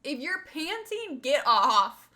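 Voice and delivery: firm slow command, told for laughs; retold scolding. Telling the panting dog on the blanket to get off, loud slow scold. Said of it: "I'm like".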